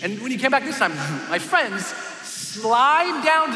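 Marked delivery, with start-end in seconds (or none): none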